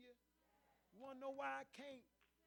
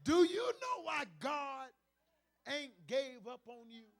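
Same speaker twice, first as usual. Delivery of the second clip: louder, thrown out to the whole room; shouted question; 13 dB louder than usual. Acted. Preacher calling the question out to the church, shouted. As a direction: hit them with it at full voice.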